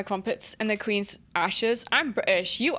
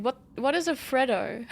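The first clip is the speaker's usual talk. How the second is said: usual speaking voice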